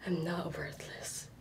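whispered affirmation. Acted. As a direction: breathe it to herself. Whispered then shouted affirmation.

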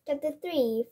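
usual speaking voice

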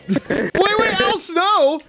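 high, stretched shout of betrayal; shocked outcry. Real, not acted. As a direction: cry out in disbelief. Game character betrays him.

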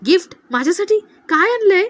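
high, loud, eager questions; excited surprise. A narrator's reading. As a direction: pitch climbs with each delighted question. दिव्याला अचानक भेट मिळते. (आश्चर्याने, उत्साहाने विचारलेलं)

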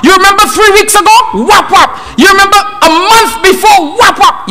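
high-pitched scolding mid-beating; scolding parent. Acted. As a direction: act out a parent rattling off old offences. Parent voiced during beating, scolding.

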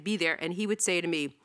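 ordinary delivery

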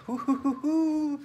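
high, slow ghostly hooting; spooky hoot. Pretend. Joking about the dark bedroom with ghostly hoots.

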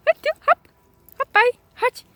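very high, sing-song coaxing; coaxing a dog. Real, not acted. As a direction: cheer the dog to jump up. (wysokie, śpiewne zachęcanie psa)

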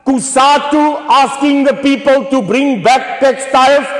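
high, loud political denunciation; full-force rally shout. Real, not acted. Speaker rails at Kusatu over Chinese-made T-shirts, full-force rally shout.

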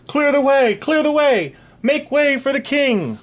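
high, loud ringing call; herald's cry. Acted. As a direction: call out to clear a crowd. Heralds clearing street for king.

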